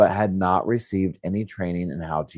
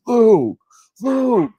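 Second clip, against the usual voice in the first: loud, very high holler at the dog; Matt; 9 dB louder than usual. Retold or imitated. Husband scolding the barking dog during nap time, imitated as a yell. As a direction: imitate an exasperated yell from the next room. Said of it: "I hear Matt going".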